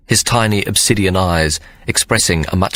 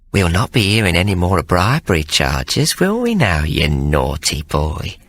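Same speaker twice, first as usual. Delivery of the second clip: cooing. A narrator's reading. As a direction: coax him like a naughty child. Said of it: "Hannah cooed".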